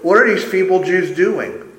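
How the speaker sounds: mock them with the question; sarcastic jeer; dripping sarcasm. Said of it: "We can hear the sarcasm dripping off at each syllable".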